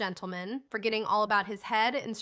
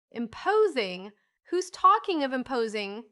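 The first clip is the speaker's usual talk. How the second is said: louder, slow, indignant repeat of imposing; loud key; 3 semitones higher, 5 dB louder than usual. A narrator's reading. Old gentleman scoffs at the widow's worry, loudly. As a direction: throw the word back at her, loudly. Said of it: "said Mister King in a loud key".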